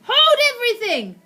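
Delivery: very high, loud shriek. Acted. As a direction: shriek to halt the room. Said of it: "Her shrieking voice".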